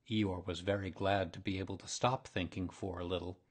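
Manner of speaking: ordinary delivery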